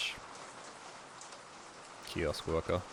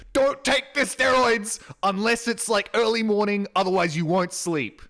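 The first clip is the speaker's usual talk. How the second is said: imitating the doctor; 13 semitones higher, 9 dB louder than usual. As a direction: squeak out the doctor's warning in a high, loud voice. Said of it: "he called me up and said".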